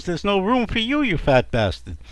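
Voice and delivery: high retort dropping onto the insult; scornful bickering. Acted. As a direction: snap back as a fed-up wife. Wife insulting husband in acted quarrel.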